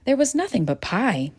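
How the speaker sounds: plain reading voice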